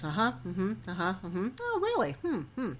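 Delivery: matter-of-fact president. Retold or imitated. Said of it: "very matter-of-fact kind of guy".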